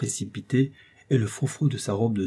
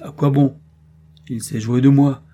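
1 mock indignation; 4 semitones higher, 9 dB louder than usual. A narrator's reading. Doctor feigns indignation.

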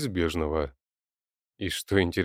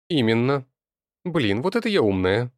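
excited; 5 semitones higher, 5 dB louder than usual. Acted. Jumps up, delighted with herself.

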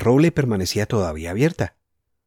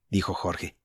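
plain reading voice

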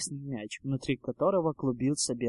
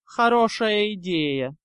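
drawn-out, higher-pitched sneering drawl; 5 semitones higher, 5 dB louder, slower than usual. A narrator's reading. Draco mocking the Hufflepuffs' idea, drawled. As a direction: drawl it slowly and loudly. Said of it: "протянул Драко".

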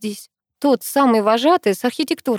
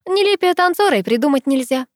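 raised, pointed put-down; spiteful tease; 7 semitones higher than usual. A narrator's reading. Girl needles her dance partner, spiteful and teasing. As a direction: say it just to needle him. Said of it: "назло проговорила я".

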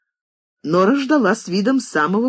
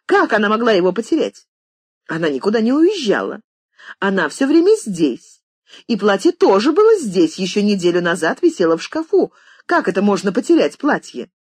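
louder and higher, impatient scolding; impatient shout; 6 semitones higher, 4 dB louder than usual. A narrator's reading. Mistress berating maid Nora, impatient shout. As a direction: push her, out of patience. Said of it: "нетерпеливо крикнула она".

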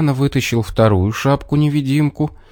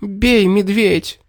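high, drawn-out cry at normal volume; urgent high command, no real shout; fox crying out; 11 semitones higher, much slower than usual. A narrator's reading. Fox orders the bear to strike, high cry. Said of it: "крикнула она".

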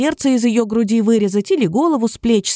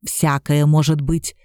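lower, slow, knowing; knowing answer; 6 semitones lower, slower than usual. A narrator's reading. Горыныч answers the princess knowingly, low and slow. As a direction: say it slowly and low, as one who knows. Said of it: "сознанием дела ответил Горыныч".

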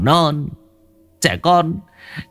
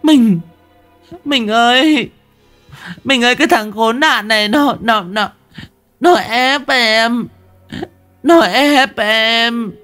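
much higher, louder whimpering plea, dragged slow; fake sobbing; 4 dB louder, slower than usual. Wife begging her husband, sobbing falsely. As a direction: wail like a wronged wife, faking tears. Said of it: "bưng mặt khóc thút thít".

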